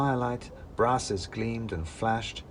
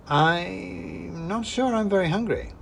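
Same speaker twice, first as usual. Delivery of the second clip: nervous, higher; 9 semitones higher, slower than usual. Acted. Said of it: "I said".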